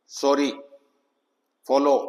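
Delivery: सॉरी, फॉलो with a short open O; short O sound. Shown as a demonstration. Teacher giving double-consonant examples, short O. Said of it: "तो short ओ की sound है".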